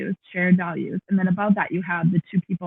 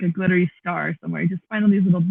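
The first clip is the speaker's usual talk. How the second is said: normal talking voice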